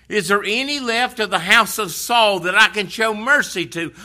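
high, loud royal call; a king eagerly sending for him; King David, eager. Acted. Preacher voices King David.

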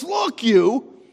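a mock-contemptuous high "Look, you."; contemptuous retort. Elder son sneering at his father, mock contempt. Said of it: "Imagine speaking like that to your dad".

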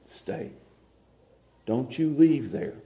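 quiet but firm; soft firm command. Acted. Paul telling Timothy to stay, soft and firm.

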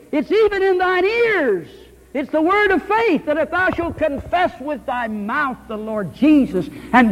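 high, ringing King James cadence; fervent scripture reading. Acted. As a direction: proclaim the verse like a prophet.

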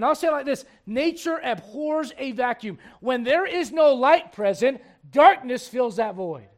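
ordinary talk, nothing marked

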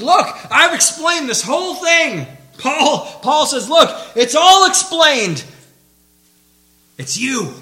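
shouted, high-pitched exhortation; full-force preaching. Real, not acted. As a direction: thunder it at the congregation.

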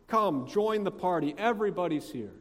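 insistent, pleading invitation. Retold or imitated. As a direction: urge him eagerly to come inside. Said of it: "He pleads with him".

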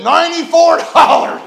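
loud, high verdict through laughter; judge's loud sentence. Retold or imitated. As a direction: bang it out like a gavel, half laughing. Magistrate bangs gavel, sentences.

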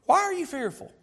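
high-pitched acted questioning; acted rebuke. Acts out Jesus questioning disciples.

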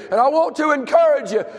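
full-throated revival preaching; preaching at full force. Acted. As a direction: preach it up to the congregation. Pastor exhorting seniors to pray.

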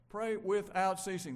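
emphatic scripture. Acted.

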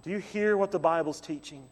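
ordinary talk

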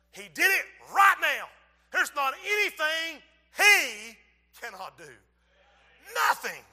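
loud, high, driving sermon voice; fiery preaching. Acted. Preacher to congregation, loud fiery preaching.

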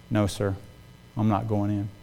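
low and very soft, holding firm; quiet, respectful refusal. Retold or imitated. Manager declining the boss's order to enter, quietly respectful. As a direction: refuse the boss meekly but firmly.

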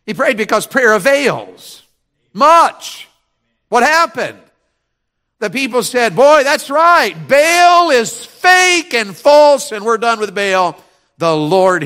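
loud, high, stretched key words; full-force preaching. Real, not acted. Preacher voices the people renouncing Baal, full-force preaching. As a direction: shout it out like a revival preacher.

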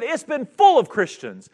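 slow furious retelling; retold angry outburst. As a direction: hammer it out slowly in anger. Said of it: "he got angry".

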